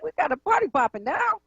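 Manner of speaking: high, quick and playful; hyped up, voice leaping up fast; excited. Real, not acted. Several callers at once.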